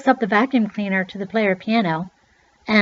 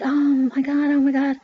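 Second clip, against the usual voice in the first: retold panic; 6 semitones higher, faster than usual. Finger caught in moving wheel.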